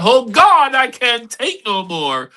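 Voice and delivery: high, loud cry opening into slow plea; desperate prayer. Acted. Pam praying after life got intricate, desperate prayer. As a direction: cry out to God, drawing it out. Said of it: "She prayed and said".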